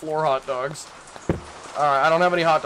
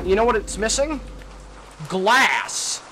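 ordinary talk

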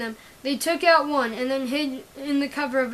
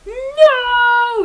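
loud, much higher cry of despair; anguished yell; 9 semitones higher, 11 dB louder than usual. Acted. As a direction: scream it as the medic falls. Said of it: "yelled the team".

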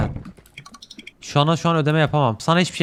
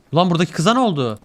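usual speaking voice

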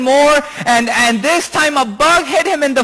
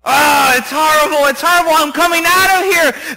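higher, loud complaining; distressed protest; 5 semitones higher, 3 dB louder than usual. Acted. Master in the foul water, loudly protesting in distress. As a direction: wail that you want out. Man protests in disgust and pain.

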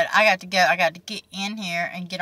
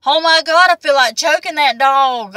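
exasperated outburst; 6 semitones higher, 7 dB louder than usual. Real, not acted. Exasperated over ruined tomato plants.

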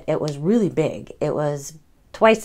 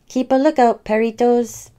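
higher, louder call to the crew; 7 semitones higher, 3 dB louder than usual. A narrator's reading. Skipito ordering his dogs to watch, higher and louder. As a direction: shout the order across the boat.

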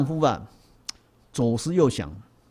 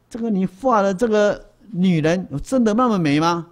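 king's amazed question; 5 semitones higher, 4 dB louder than usual. Acted. King asks the painter, amazed.

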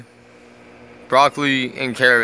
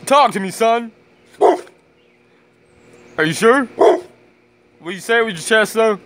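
high, playful teasing of the dog; goading his dog to bark, high teasing voice; teasing pet voice; 7 semitones higher, 3 dB louder than usual. Real, not acted.

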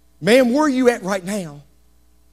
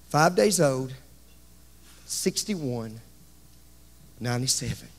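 hushed, low, grave tally; subdued funeral gravity; somber recollection; 7 semitones lower, 7 dB quieter than usual. Real, not acted. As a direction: let each age sink quietly. Recounts the ages just buried.

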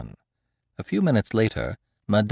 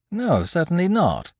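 astonished; 8 semitones higher than usual. Acted. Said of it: "she said, greatly astonished".

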